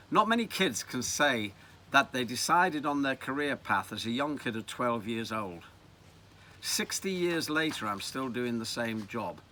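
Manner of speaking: plain reading voice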